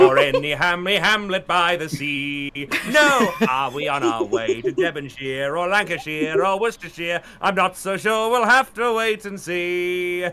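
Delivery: high, theatrical British character voice; British Mister Toad. Acted. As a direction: ham it up like a cartoon Englishman. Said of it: "thinking about Mister Toad's Wild Ride".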